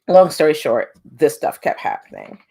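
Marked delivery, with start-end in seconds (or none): none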